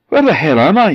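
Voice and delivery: high, loud confused outburst; startled awake and lost; bewildered alarm. Acted. Man wakes confused, no memory.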